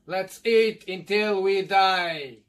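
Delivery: drawn-out booming slogan; hammed-up call to eat; slow loud catchphrase. Shouting catchphrase to start eating.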